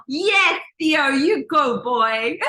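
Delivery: loud, drawn-out cheer. Real, not acted. Coach cheers a participant who congratulates himself, exuberant. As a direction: whoop them on with real delight.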